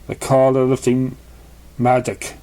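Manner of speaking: usual speaking voice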